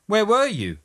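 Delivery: high-pitched demanding question; insistent bumpster. A narrator's reading.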